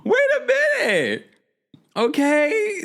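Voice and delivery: very high, drawn-out exclamations; thrilled, high-pitched hype; hyped approval. Real, not acted. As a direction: squeal it out, stretching each phrase. Hyped by viewers' taglines.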